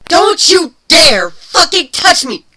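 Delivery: a furious screeched warning. Acted. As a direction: scream it at him, stretched out. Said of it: "Kuno screeched".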